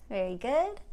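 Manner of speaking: ordinary talk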